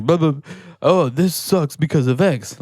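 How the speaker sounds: high, whiny mock complaint. Mocking a complaining coworker, whiny and high. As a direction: whine it like a moaning coworker. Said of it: "as opposed to being like".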